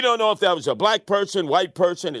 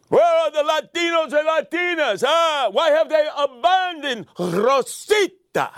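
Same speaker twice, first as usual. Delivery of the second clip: mock anguished cry; 7 semitones higher than usual. Ranting to the puppet Rosita, a hammed-up wail.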